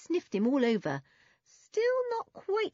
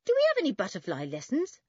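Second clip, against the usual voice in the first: higher, louder, quick question; mock-innocent boyish question; grinning tease; 9 semitones higher, 5 dB louder than usual. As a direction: ask a mate cheekily with a grin. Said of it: "asked Toby with a grin".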